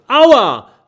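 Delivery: high, loud yelp of pain; sudden cry of pain. A narrator's reading. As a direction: cry out as if something hurt you. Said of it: "Plötzlich schreit Mathilda auf".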